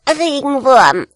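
high, loud, drawn-out jeer; lachende Kinder. Acted. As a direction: jeer it like laughing kids. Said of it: "lachten die Kinder". (lachend spottendes Kind)

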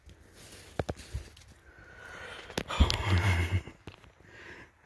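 long, heavy exhale; real drawn-out sigh; big sigh. Real, not acted. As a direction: let out a big long breath after the rush. Relief after the bear kill.

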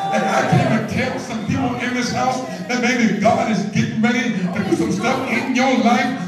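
loud, sung-out preaching climax; preacher whooping to the church; preaching at full force. Acted. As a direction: whoop it over the organ. Sermon climax over music.

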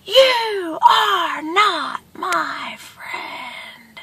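very slow, high, softened call; each word stretched and faint; distant echo. A narrator's reading. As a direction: imitate the words drifting back from far away. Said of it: "he hears from the distance".

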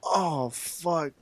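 ordinary talk, nothing marked

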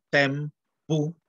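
"tempu" leído con una E de apoyo entre T y M; pronunciación egiptológica. Shown as a demonstration. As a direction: di "tempu" despacio, con E añadida. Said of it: "esto se lee". ("tempu", pronunciación convencional con vocal E)

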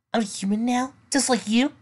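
high, disbelieving question; stunned disbelief. Acted. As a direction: ask it stunned. Stunned realization.

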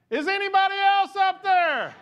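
high, loud desperate shout; calling out. Acted. Man refusing God's answer, shouting high and loud. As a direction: shout the punchline up the cliff.

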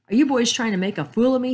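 put-on an old man's indignant outburst; angry demand. A narrator's reading. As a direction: shake your fist and fire the question. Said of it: "he asked, shaking a fist at them".

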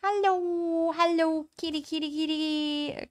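high-pitched pet-talk coo; cooing to a cat. Real, not acted. Player greeting the cat in the game, high cooing. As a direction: coax the kitty in a high little voice.

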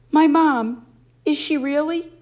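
high, loud, catching voice; dreading question. A narrator's reading. Boy asks if his mother is dead, fearful. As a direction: ask it fearing the answer. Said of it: "afraid to ask about mother".

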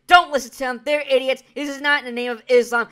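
loud, sneering parody of the mayor; mocking mayor quote. Vlogger mocks the Philly mayor, loud and sneering. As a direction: bellow it like a dismissive politician. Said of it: "He basically said".

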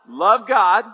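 loud emphatic reply; said like you mean it. Real, not acted. Congregation led to shout the vision loudly. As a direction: declare it with full force. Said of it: "Say it like you mean it".

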